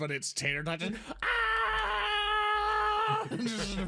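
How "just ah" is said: mock battle cry. Miming Braveheart charge.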